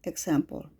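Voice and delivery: ordinary delivery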